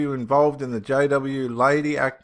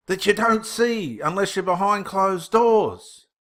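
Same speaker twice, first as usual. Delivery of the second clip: pitched-up, slowed preacher's delivery; fervent preaching; 5 semitones higher, 4 dB louder than usual. Acted. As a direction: preach it like a pulpit warning. Preaches at the camera.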